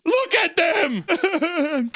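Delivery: high, drawn-out mock squeal breaking into laughter; hammed-up high exclamation. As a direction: squeal it out slowly, far above your voice. Acts out a reaction, mockingly.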